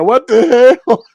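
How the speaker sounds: high, slow, loud disbelief; stunned morning-after shock; shocked outburst. Retold or imitated.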